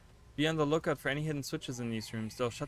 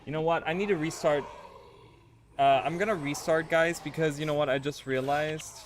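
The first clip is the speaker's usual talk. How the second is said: louder, higher, fed-up announcement; exasperated; 4 semitones higher, 8 dB louder than usual. Real, not acted. Streamer tells chat they must restart, loud exasperated. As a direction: blurt it out, done with this.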